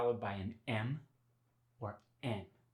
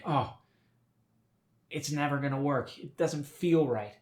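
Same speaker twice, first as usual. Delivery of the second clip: louder, higher frustrated grumble, acted; mock frustration; 4 semitones higher, 4 dB louder than usual. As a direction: play a discouraged student giving up. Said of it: "get frustrated and think".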